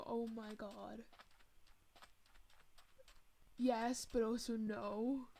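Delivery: exasperated reply. Retold or imitated. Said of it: "And I was like".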